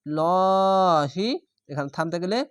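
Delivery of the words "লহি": লহি very slow with a dark heavy l; মোটা করে লহি; heavy recitation. Shown as a demonstration. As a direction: chant লহি thick and long. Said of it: "মোটা করে পড়তে হবে".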